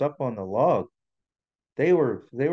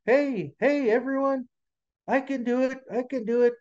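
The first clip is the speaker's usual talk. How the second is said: tiny high muskrat voice; squeaky-high little animal, not shouting; 11 semitones higher than usual. A narrator's reading. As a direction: pipe up small and high, trying to be noticed. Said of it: "he had a little voice".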